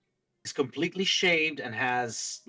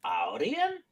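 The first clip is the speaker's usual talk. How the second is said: soft, musing surprise with swooping pitch; intrigued djinn; 7 dB quieter than usual. Acted. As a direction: muse softly, rising into a puzzled question. Djinn voices surprise at overheard tongue.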